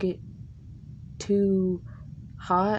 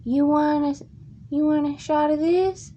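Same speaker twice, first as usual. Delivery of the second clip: put-on old lady offering; 8 semitones higher than usual. Old lady on the bus offering a shot, higher voice. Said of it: "she's like".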